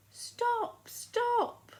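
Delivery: high, calling stop stop; old woman's shout. Acted. Little old woman shouting after the gingerbread man, high and loud. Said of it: "She shouted".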